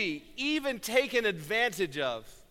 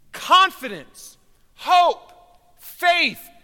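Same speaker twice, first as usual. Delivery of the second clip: three words, each higher and louder; climbing sermon shout, higher and louder; rising preached shout; 9 semitones higher, 13 dB louder, slower than usual. Acted. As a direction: build to a ringing cry on faith. Preacher builds to climax.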